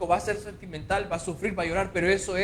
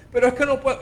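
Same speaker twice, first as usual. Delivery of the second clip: high, loud put-on protest; whiny mock protest; 7 semitones higher, 7 dB louder, much faster than usual. Preacher mimicking a believer who refuses to let go, loud whine. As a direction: whine it like a stubborn churchgoer.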